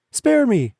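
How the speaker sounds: high, loud squeaky plea of a tiny mouse; pleading little mouse; begging mouse. A narrator's reading. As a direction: beg for your life in a tiny mouse voice. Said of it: "begged the poor mouse".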